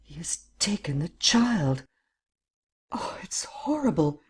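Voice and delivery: slow, breathy horror, voice lifting; half-whispered anguish to oneself; horrified. A narrator's reading. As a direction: whisper in horror, rising to a high cry. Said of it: "she said to herself".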